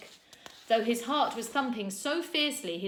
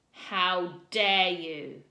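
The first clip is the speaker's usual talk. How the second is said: slow, louder, cold fury; enraged; 7 dB louder, much slower than usual. A narrator's reading. Teacher rebuking two boys, slow cold fury. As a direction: spit it out slowly, fit to kill.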